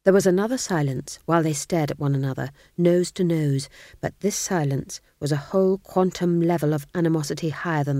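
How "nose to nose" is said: ordinary delivery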